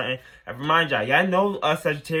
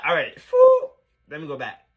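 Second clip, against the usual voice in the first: normal talking voice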